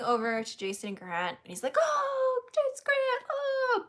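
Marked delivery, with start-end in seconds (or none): 1.8-3.8 very high, gushing mock squeal; hammed-up fanboy greeting, pitched way up; imitating kid-like excitement. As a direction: squeal it like a thrilled little kid. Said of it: "he was like a little kid".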